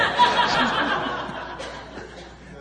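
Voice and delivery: a long, high, breathy burst of laughter; hearty laugh. Real, not acted. Pastor laughing with the congregation at his own story.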